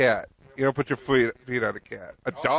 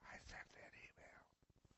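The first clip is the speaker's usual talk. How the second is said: whisper; 38 dB quieter, slower than usual. Pretend. Cat confesses in whisper.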